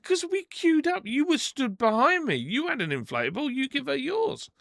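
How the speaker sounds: high-pitched, emphatic retort; indignant argument. Retold or imitated. Arguing with woman over the inflatable, indignant. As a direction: argue back with the pitch right up.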